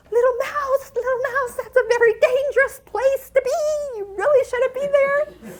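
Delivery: high, loud, near-crying plea to a tiny animal; pleading with mouse. Retold or imitated. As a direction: beg the little mouse to get away, voice way up. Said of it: "and I thought".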